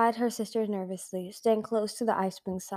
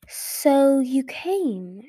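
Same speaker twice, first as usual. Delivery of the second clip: drawn-out, higher and louder villain's hiss; menacing hiss; 5 semitones higher, 5 dB louder, much slower than usual. A narrator's reading. Dragon queen greeting her challengers, menacing hiss. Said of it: "she hissed".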